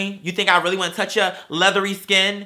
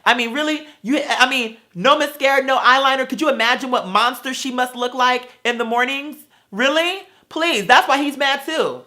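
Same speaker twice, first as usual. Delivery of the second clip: higher and louder, scornful disbelief; incredulous mocking rant; 5 semitones higher, 3 dB louder than usual. Real, not acted. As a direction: pile on the disbelief, really?. Ranting, mocking a customer's looks.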